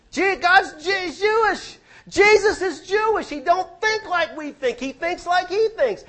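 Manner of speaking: preaching at full force. Acted. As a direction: climax the point with a high shout. Preaching peak.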